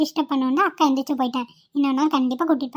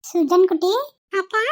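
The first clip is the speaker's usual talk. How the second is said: put-on higher little-boy voice, eager; a small boy pointing his sister out; excited child; 6 semitones higher than usual.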